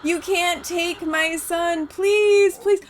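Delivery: slow, high-pitched mother's plea; pleading and begging. Pretend. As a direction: beg theatrically, bursting out on the please. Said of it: "[name] is pleading and begging".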